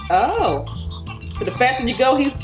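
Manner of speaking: normal talking voice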